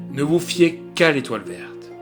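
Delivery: ordinary delivery, nothing marked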